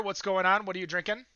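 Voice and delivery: normal talking voice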